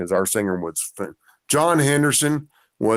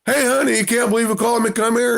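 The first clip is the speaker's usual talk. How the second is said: high excited call to his wife, much higher; 12 semitones higher, faster than usual. Retold or imitated. Fan calling his wife to the phone, high and thrilled. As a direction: call her over, thrilled. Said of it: "he called his wife over".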